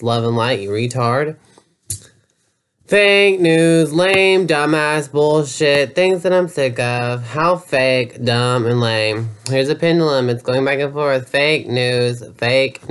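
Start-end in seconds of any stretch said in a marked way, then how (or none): none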